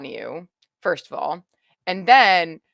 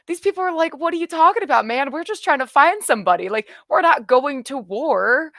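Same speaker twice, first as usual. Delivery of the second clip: mock baffled voice; 7 semitones higher than usual. Quoting baffled people.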